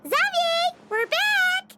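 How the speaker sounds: high bright hail across the set; calling out. Real, not acted. Arriving back, calling out to Xavier across the set. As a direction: call out to a friend from a distance.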